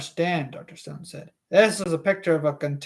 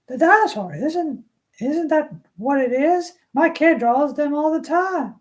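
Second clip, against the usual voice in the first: higher female character voice; Alice Levin voice; 9 semitones higher than usual. Acted. Alice Levin to Doctor Stone, higher female voice. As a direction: play a chatty mother, pitched up. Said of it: "some sort of female voice".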